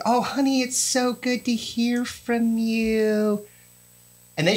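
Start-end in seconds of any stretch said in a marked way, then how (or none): 0.1-3.5 higher, slow sweet mom voice; drawn-out sweet greeting; imitating mom. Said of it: "this is".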